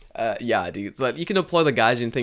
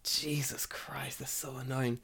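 slow whispered sigh of annoyance; exasperated whisper; 15 dB quieter, slower than usual. Real, not acted. Complaining about NHK visits, whispered. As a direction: whisper it, fed up.

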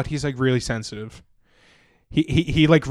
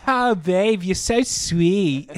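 put-on sweet girly voice; 5 semitones higher, much slower than usual. Girl quoted, high, slow.

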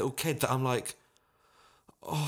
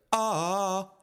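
a short sung ah, higher and louder; mock sung ah; 8 semitones higher, 4 dB louder than usual. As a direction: imitate the boy's one lone note. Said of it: "and he goes".

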